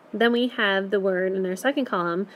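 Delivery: ordinary talk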